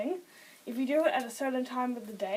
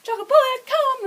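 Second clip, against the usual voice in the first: very high, loud call; high-pitched, loud come-here command; pet command call; 11 semitones higher, 14 dB louder than usual. Shown as a demonstration. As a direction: summon a pet from across the room, pitched right up. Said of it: "It's just like that".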